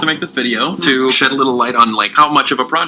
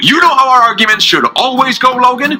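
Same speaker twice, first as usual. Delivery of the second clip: lightly voiced, cocky challenger, a bit higher; taunting Roman puppet; 9 semitones higher, 3 dB louder than usual. Roman puppet taunting Logan to fight. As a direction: goad him into a showdown.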